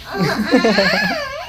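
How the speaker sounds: a high, delighted burst of laughter. Real, not acted. Laughs after making child laugh.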